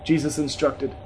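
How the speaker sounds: usual speaking voice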